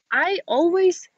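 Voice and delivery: normal talking voice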